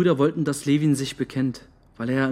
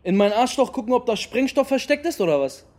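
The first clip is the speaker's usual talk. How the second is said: higher and louder, mocking fury; angry yell; 6 semitones higher, 3 dB louder than usual. Real, not acted. Boy shouting at the security man, angry yell. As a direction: throw the accusation back at him.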